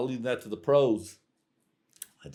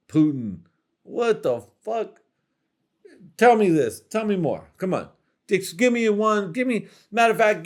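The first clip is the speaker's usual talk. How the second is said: mock outraged; 5 semitones higher than usual. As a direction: demand answers, hammed-up disbelief. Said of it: "me, I'm like".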